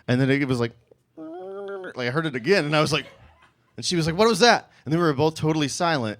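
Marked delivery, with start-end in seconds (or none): 1.2-2.1 very high, faint squeak; barely audible high noise; tiny soft voice. Retold or imitated. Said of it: "a tiny little soft voice". 4.2-4.7 higher-pitched alarmed question; startled date. Retold or imitated.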